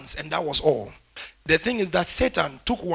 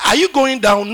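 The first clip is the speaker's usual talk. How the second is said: loud challenging question; 6 semitones higher, 9 dB louder than usual. Retold or imitated. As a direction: fire the question at them.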